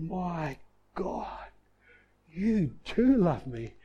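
soft, slow, hushed wonder; awed, tender realization. Retold or imitated. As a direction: breathe it out in awe, slowly. Moment of revelation, about to weep.

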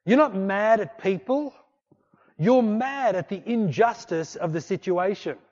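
normal talking voice

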